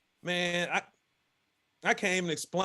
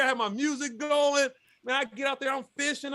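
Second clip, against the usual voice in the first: ordinary talk, nothing marked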